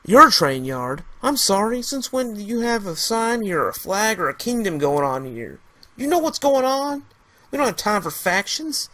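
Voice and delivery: high, loud indignant rant; mock their claim with rising fury; angry. Trevor scorning the rifleman's claim, angry rant. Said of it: "Trevor got mad again".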